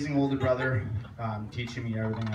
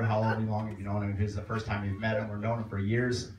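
usual speaking voice

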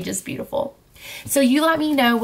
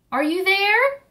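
high calling question; calling through the cottage, higher; girl still calling to grandma; 10 semitones higher than usual. Acted. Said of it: "she called".

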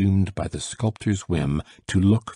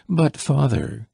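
higher-pitched mild protest; said aloud; 7 semitones higher, 3 dB louder than usual. A narrator's reading. Son to his dying father, protesting aloud. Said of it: "he said aloud".

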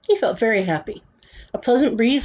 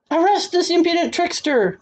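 high-pitched, indignant order; shouted command; 9 semitones higher than usual. A narrator's reading. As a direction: call the guard in a high royal voice. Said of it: "shouted the king to the captain".

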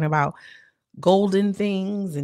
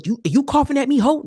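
loud, high, offended snap; real-sounding snap; 8 semitones higher, 9 dB louder than usual. Retold or imitated. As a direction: confront her for coughing. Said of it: "Now, that sounded real".

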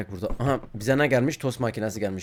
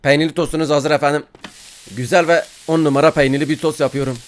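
ordinary talk, nothing marked

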